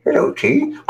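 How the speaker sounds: high puppet voice; squeaky puppet talk; Muppet Baby voice. Retold or imitated. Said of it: "That voice was a lot different than that".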